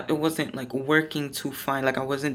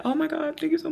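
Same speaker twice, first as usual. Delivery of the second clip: gushing thanks; 11 semitones higher, faster than usual. Retold or imitated. Thanking the roommate for sharing her book, high and gushing. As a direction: gush your thanks up high. Grateful reply to roommate's offer.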